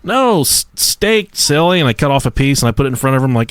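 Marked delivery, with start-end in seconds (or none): 0.0-1.8 put-on higher-pitched talking-to-a-little-kid voice; playful parental correction. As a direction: gently set a silly child straight, pitched up. Parent correcting small child in story.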